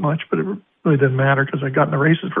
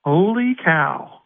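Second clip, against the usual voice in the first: long, drawn-out announcer's exclamation; Jack Miller's catchphrase; 3 semitones higher, much slower than usual. Retold or imitated. Announcer quoting a colleague's favorite term, drawn out. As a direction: stretch it out like a favorite track call. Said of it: "And Jack's was".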